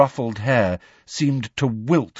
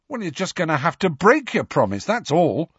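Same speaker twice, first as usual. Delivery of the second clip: higher-pitched, decisive insistence; firm; 8 semitones higher than usual. A narrator's reading. Ron tells Hermione to break her promise, firmly. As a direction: lay it down as settled. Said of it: "said Ron firmly".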